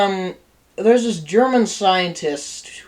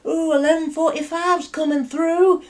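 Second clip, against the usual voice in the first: eager train spotter; 10 semitones higher than usual. Retold or imitated. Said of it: "he's like".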